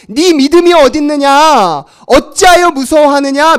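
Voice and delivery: loud, high scolding; Jesus scolding those who came to him; loud rebuke. Acted. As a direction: berate the frightened believers. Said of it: "혼을 내시면".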